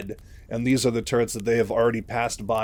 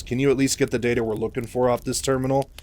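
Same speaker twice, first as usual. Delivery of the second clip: ordinary delivery, nothing marked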